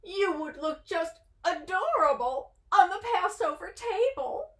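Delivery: high doting coo; lightly voiced doting aunt. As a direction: gush over him sweetly. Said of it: "cooed Auntie Bertha".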